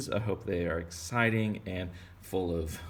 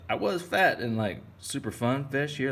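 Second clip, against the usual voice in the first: high, louder cheerful fish; 7 semitones higher, 4 dB louder than usual. Pretend. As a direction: play the happy fish. Fish recalls happier days.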